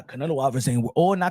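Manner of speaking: ordinary delivery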